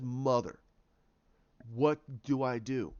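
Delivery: low, halting appeal; subdued pleading. Acted. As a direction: gently act a lost daughter at her mother's grave. Daughter pleading at mother's grave.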